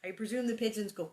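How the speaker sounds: usual speaking voice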